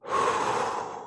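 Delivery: long breathy exhausted sigh; tired sigh. Real, not acted. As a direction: let out a winded sigh. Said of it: "I'm out of breath".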